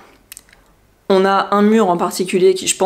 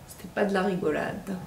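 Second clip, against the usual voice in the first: soft muttered repeat; quiet echo; 11 dB quieter than usual. Real, not acted. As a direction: echo it under your breath. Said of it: "et ça, c'était pas de la rigolade".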